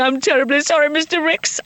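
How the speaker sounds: high posh stage apology; posh accent. Acted. Bursting onstage to apologise to the director, high posh accent. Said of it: "I've lost the accent".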